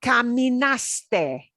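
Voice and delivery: caminaste drawn out slowly, each syllable clear; slow demo. Shown as a demonstration. Teacher modeling the conjugation, very slowly. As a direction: stretch out caminaste. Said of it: "here's what it will sound like".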